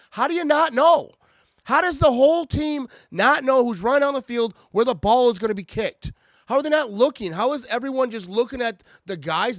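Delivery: loud, high, exasperated shouting; angry rant. Real, not acted. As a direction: yell your disbelief at the team. Ranting about a blown punt.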